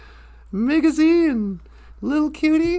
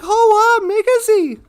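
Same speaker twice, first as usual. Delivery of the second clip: higher, louder wowed cry; amazed exclamation; 5 semitones higher, 6 dB louder, slower than usual. Acted. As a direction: call out in delighted awe. Little bear awed while flying.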